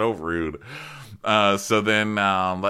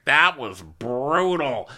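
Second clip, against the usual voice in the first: slow, higher, drawn-out outrage; mock venting; 6 semitones higher than usual. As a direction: imitate a captain emphatically complaining to staff. Captain venting to his staff.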